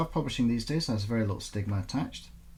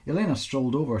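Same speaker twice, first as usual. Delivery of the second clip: ordinary delivery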